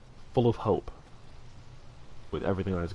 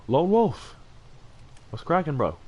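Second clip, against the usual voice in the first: upbeat hype shout-out; excited shout-out; 7 semitones higher than usual. Real, not acted. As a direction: call out the name like spotting a friend. Greeting a chat member by name.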